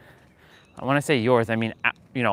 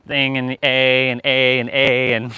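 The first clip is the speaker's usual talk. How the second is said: Canadian accent imitation. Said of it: "kinda like this".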